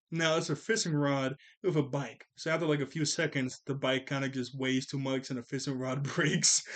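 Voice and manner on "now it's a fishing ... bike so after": ordinary talk, nothing marked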